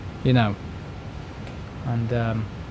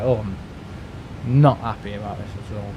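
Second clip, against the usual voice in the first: low, softer complaint; quiet and discreet; 4 semitones lower, 6 dB quieter than usual. Retold or imitated. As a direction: mutter it discreetly so the kids don't hear. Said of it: "saying it quietly and discreetly".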